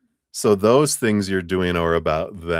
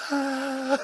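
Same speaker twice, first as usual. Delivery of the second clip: scared gasp; 5 dB quieter than usual. Retold or imitated. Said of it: "all they're doing is going".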